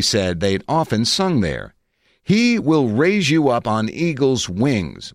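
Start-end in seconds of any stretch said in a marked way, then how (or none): 2.2-5.1 slow, higher, solemn quoting of a hymn; intoned hymn line. A narrator's reading. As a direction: intone it slowly like a preacher. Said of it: "Moulton intoned".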